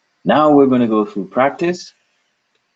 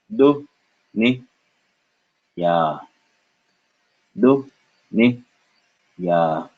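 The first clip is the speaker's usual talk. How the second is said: Do, Ni, Ya as separate short beats with pauses; syllable by syllable; 2 semitones higher, much slower than usual. Shown as a demonstration. Teacher drilling a word, Do Ni Ya one syllable at a time. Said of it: "one syllable at a time".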